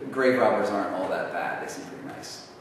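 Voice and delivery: normal talking voice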